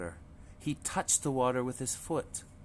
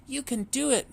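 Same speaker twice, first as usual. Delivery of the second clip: high, louder reassurance; encouraging mother duck; 14 semitones higher, 4 dB louder than usual. A narrator's reading. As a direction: cheer the little ones on. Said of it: "Mama said".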